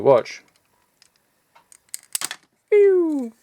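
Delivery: ordinary talk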